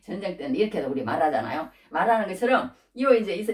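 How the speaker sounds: normal talking voice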